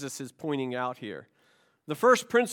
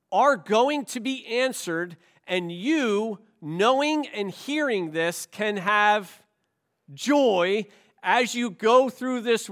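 raised, measured pulpit delivery with punched key words; emphatic preaching; 5 semitones higher, 5 dB louder than usual. Real, not acted. Preacher urging the congregation to have joy, emphatic and forceful.

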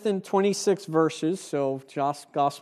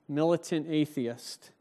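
ordinary talk, nothing marked

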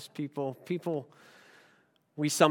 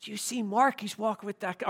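hushed, softer gossip; mock gossip whisper; 3 semitones higher, 7 dB quieter than usual. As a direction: imitate students passing a rumor under their breath. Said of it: "Everyone's whispering".

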